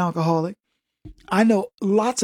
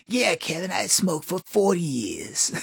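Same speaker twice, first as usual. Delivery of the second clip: deep, slow, softer smoker rasp; smoker lady voice; 4 dB quieter than usual. Retold or imitated. Edna answering Kevin, deep smoker's voice. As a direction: drag it out like forty years of smoking. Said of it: "her voice is deeper than mine".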